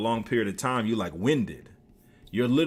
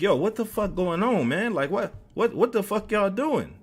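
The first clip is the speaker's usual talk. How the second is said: high-pitched incredulous questioning; retold disbelief at the scene; baffled confronting; 9 semitones higher than usual. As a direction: step in, exasperated, pitch way up. Retold scolding the fighters.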